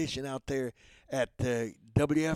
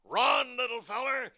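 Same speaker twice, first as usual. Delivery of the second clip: bulldog shouting; 10 semitones higher than usual. Acted. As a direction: yell at the duckling to flee. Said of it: "Chopper tells him to run".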